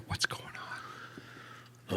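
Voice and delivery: slow, hushed ghostly whisper; imitated whisper. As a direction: whisper it slowly, imitating a voice on tape. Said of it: "It was like a whisper".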